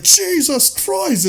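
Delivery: very high, slow horrified exclamation; shocked Arthur. Retold or imitated. Arthur seeing his knight killed, high slow horror. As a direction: gasp it out in drawn-out horror. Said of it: "And then Arthur's like".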